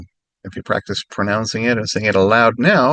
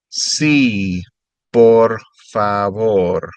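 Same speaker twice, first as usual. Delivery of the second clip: "Sí, por favor" drawn out slowly; slow careful repeat; 4 dB louder, much slower than usual. Shown as a demonstration. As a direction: stretch it out for the learner.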